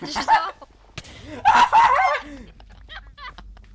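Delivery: high shrieking burst of laughter. Real, not acted. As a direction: cackle loudly.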